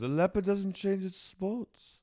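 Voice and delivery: high, slow, drawn-out impression; Lisa. Quoting her saying about cheaters, slow high mimicry.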